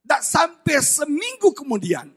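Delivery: ordinary talk, nothing marked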